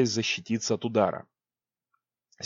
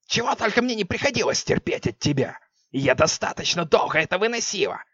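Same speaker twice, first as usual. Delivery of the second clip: furious witch; 8 semitones higher than usual. Acted. Witch berating queen.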